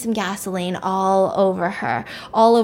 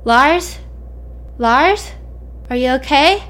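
questioning call; 4 semitones higher, slower than usual. Retold or imitated. Brother at the door checking on silent Lars, calling out. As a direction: call out the name, pause, call with a lift.

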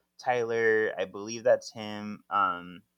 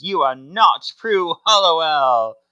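accusing Iota; 6 semitones higher, 10 dB louder than usual. Retold or imitated. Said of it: "And Iota says".

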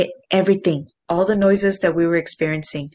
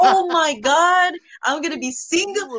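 laughing panic; 10 semitones higher than usual. Retold or imitated. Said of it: "I was like".

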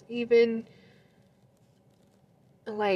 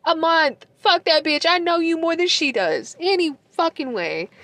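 raised, high-pitched angry rant; mad; 8 semitones higher, 4 dB louder than usual. Retold or imitated. Telling the ex off about the new girlfriend, mad. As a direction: pitch it high and loud, indignant and jealous. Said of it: "I was mad".

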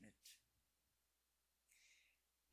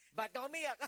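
a father calling out over the sobbing; mock alarmed daddy; louder, higher questioning dad; high, loud worried father; 8 semitones higher, 8 dB louder than usual. Father asks crying child.

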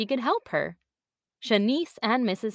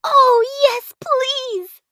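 put-on high eager child begging, much louder; excited little girl; 9 dB louder, much slower than usual. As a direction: squeal it with delight. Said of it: "letting go of her mother's hand and clapping".

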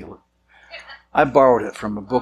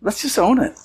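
playful admission; 7 semitones higher than usual. Retold or imitated. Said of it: "I just said".

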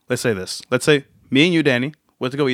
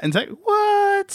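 mock disbelief; much slower than usual.

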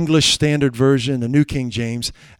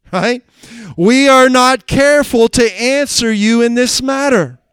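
loud, high, slow preacher's reading of the king's threat; fiery sermon delivery; preaching at full force; 8 semitones higher, 6 dB louder than usual. Acted. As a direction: thunder out the scripture. Shadrach's answer preached.

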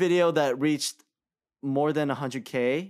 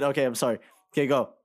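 ordinary talk, nothing marked

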